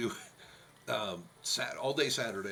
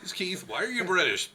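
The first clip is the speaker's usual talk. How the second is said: puzzled caller; 7 semitones higher, 6 dB louder than usual. Retold or imitated. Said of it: "They're like".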